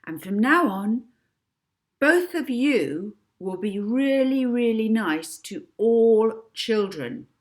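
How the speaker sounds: loud, slow deliberate orders; girl hypnotist to two women; hypnotic commands. A narrator's reading. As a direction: spell out their new lives. Molly hypnotising.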